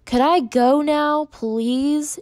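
put-on narrated child's exasperated asking; impatient. A narrator's reading. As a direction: draw out a put-upon, impatient request. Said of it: "she asked impatiently".

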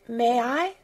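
mildly tremulous small plea. A narrator's reading. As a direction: ask timidly, pitch lifting. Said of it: "she said tremulously".

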